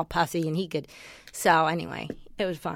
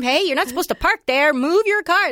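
mock scolding crossing guard; 6 semitones higher, 3 dB louder than usual. Crossing guard scolding parked drivers.

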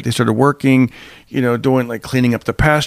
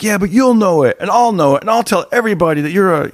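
higher-pitched, insistent comeback; mock indignant boy; 6 semitones higher than usual. Boy warning his cheating friend, mock indignant. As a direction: press the point, building into a threat.

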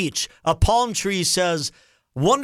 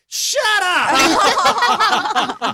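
laughing exasperation; 11 semitones higher, 5 dB louder, much slower than usual. Real, not acted. Dad exasperated at teens.

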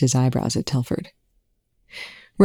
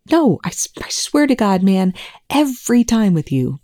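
pitched much higher, exasperated "no, I swear"; exasperated protest; 7 semitones higher than usual. Acted. Volker protests to Rush, pitched high and exasperated. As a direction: throw up your hands at him.

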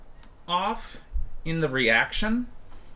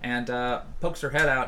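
normal talking voice

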